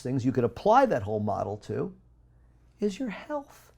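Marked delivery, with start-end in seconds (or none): 2.8-3.7 emphatic high peak. Real, not acted.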